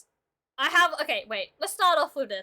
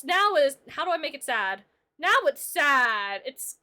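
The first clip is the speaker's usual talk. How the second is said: ordinary talk, nothing marked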